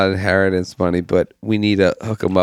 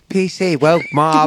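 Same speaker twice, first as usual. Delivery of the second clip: Jordan Peterson voice; 7 semitones higher, slower than usual. Pretend.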